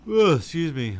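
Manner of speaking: real yawn swallowing the words; mid-yawn. Real, not acted. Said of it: "I don't mean to yawn on you guys".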